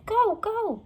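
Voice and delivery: urgent high call to go. A narrator's reading. Jack urging Annie to flee, urgent high call.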